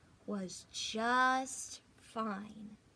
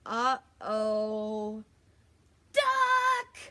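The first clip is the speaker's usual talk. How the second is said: scream; 8 dB louder, much slower than usual. Acted. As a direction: yell the name across the farm in fury. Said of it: "screamed Farmer Brown".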